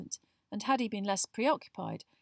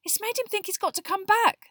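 very high, loud, agitated outburst; angry Harry; 14 semitones higher, 8 dB louder than usual. A narrator's reading. Boy slamming his fist over the letter, furious. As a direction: rant at high pitch, furious. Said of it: "now slamming his fist on the table".

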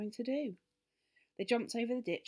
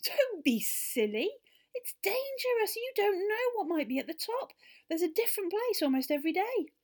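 horrified; 14 semitones higher, 8 dB louder than usual. Acted. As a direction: recoil in horror as the little elf. Elf warns children in horror.

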